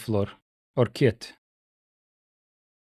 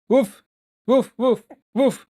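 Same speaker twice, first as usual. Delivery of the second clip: high mock woofs; playful barking; 9 semitones higher than usual. Streamer barks at his dog while it arrives, high playful mock barking. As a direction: bark playfully at the dog, pitched up.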